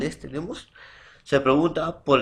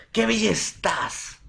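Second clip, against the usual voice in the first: "Qué bella estás" high and drawn out; exclamation; 8 semitones higher than usual. Shown as a demonstration. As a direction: exclaim it slowly with raised pitch. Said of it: "Signos de exclamación".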